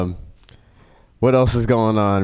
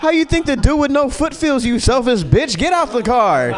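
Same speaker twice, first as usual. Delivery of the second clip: high, laughing indignant telling-off; mock outrage. Driver kicks selfish passenger out, high laughing outrage. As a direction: scold her like she's unbelievable. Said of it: "I was like".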